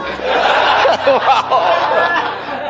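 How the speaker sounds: laugh. Real, not acted. Preacher laughs at own joke.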